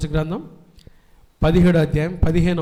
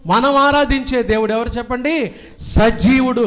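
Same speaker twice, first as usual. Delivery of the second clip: raised, ringing pulpit voice; fiery preaching; 7 semitones higher, 6 dB louder than usual. Acted. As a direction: preach it out to the whole church. Preacher at full force. (ఉత్సాహంగా బోధించే స్వరం)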